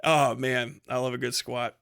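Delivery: ordinary talk, nothing marked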